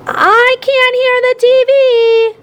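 high, slow holler; hollered complaint. A narrator's reading. Ty hollers at his noisy family while turning up the volume. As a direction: shout over everyone to be heard. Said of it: "Ty hollered".

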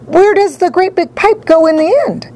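high, frantic question; shriek. A narrator's reading. Mother asks where the pipe ends, high shriek. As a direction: ask it in a high, panicked screech. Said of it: "shrieked Missus Salt".

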